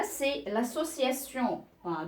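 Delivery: usual speaking voice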